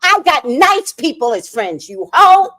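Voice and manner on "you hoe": loud, high insult yelled; sudden angry yell. Real, not acted. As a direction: spit it out at her, loud and high. Insulting the absent woman.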